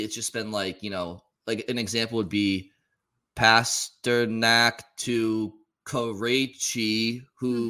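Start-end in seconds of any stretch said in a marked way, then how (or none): 3.4-7.7 every name stretched very slow; drawn-out slow call. Retold or imitated. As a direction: drag each syllable out like a robotic announcer. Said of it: "he says everybody's name like it's ten syllables".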